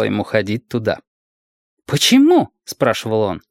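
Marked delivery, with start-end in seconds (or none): 1.9-2.5 put-on high, louder child's question; high-pitched boy wanting a reason; curious little boy. A narrator's reading. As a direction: ask it as a puzzled small boy. Small boy asks guardian why.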